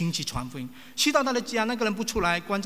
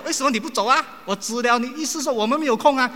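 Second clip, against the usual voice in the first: louder, higher impatient complaint; exasperated host; 7 semitones higher, 7 dB louder than usual. Retold or imitated. Host pointing at the clock, louder impatient huff. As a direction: play the annoyed host wanting him gone. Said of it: "他就觉得：".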